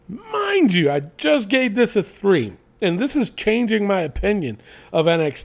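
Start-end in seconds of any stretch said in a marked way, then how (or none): 0.1-0.9 mind you, pitched way up; a sharp high "mind you"; high emphatic repeat. Shown as a demonstration. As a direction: say "mind you" again much higher. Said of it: "repeats with big pitch jump".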